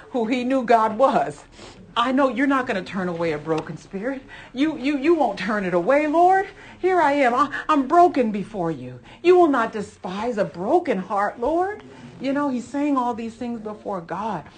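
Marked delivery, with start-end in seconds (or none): 2.0-11.8 voicing David's plea, louder and higher, climbing on Lord; pleading prayer. Preacher voicing David begging God, impassioned plea. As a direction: cry out to God as the broken king, swelling.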